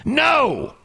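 sudden loud high NO; angry shout. Retold or imitated. Man on the phone refusing ikea's offer, angry shout. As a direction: yell it at customer support.

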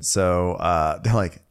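ordinary delivery

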